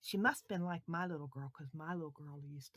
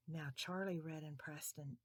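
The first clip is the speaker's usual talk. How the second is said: usual speaking voice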